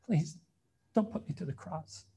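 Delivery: soft pleading, dropping away; begging for my life. Retold or imitated. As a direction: plead quietly for mercy. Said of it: "those men groveled before Pilate".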